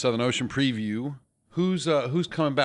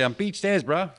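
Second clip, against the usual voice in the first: higher put-on Kiwi bro voice; laid-back New Zealand accent; 6 semitones higher than usual. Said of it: "all I can do is say".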